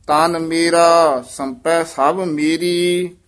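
measured chant with held line endings; scripture recitation. Acted. Teacher models Gurbani for students, measured chanted recitation. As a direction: recite evenly, pausing between phrases.

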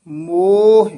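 ਮੋਹ with a long, loud o and light h; lengthened o. Shown as a demonstration. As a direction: stretch the o in ਮੋਹ. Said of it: "ਮੰਮੇ ਦਾ ਹੋੜਾ ਵਧਾ ਦੇਣਾ".

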